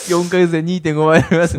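straight-faced mock pitch; deadpan meme voice. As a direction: say it dead serious like a scam ad. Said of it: "みたいな".

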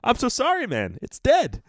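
high-pitched imitated South African guide; mock-dumbfounded South African accent; dumbfounded South African apology. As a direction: mimic a stunned South African apologizing, voice shooting up. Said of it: "that's why he was so dumbfounded".

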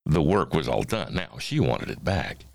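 ordinary delivery

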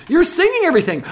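put-on very high, loud kids' accusation; children calling out. Pupils telling teacher what they did, calling out high and loud. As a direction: shout it like gleeful tattling pupils. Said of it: "And they go".

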